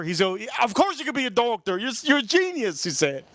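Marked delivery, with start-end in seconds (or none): 0.6-2.8 high-pitched, exasperated insistence, imitated; imitated angry counselor, pitched way up; mad counselor. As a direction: retell a counselor getting worked up and insistent. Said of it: "He got so mad, the counselor".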